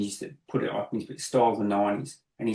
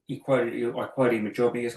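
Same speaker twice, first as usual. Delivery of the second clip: ordinary talk, nothing marked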